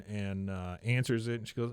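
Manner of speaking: ordinary delivery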